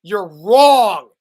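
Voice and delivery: playfully loud you're wrong; mock outrage. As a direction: bark it at him in fake outrage. Playful argument over decades.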